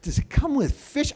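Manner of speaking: high-pitched, incredulous parroting; squeaky mocking repeat; mocking echo. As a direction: parrot the question back in disbelief. Mocking echo of kid's question.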